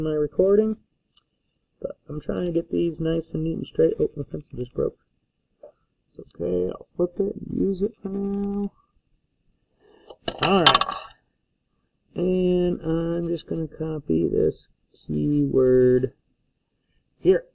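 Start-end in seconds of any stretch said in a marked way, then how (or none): none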